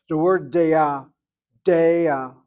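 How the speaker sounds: "deah" loud, stressing the first syllable. Shown as a demonstration. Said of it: "notice how I'm saying it".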